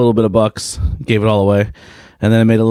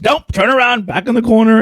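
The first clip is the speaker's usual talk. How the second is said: much higher, firm mom voice; high stern mom; 12 semitones higher than usual. Retold or imitated. Mom punishing her son, high stern voice. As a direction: send him back to the corner. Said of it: "she goes".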